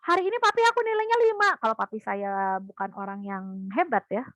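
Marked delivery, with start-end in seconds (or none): 0.1-1.5 put-on high, fast proud kid voice; eager child to father; excited child. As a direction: chirp it like a kid showing off. Child reports score.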